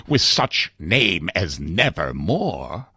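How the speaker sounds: ordinary delivery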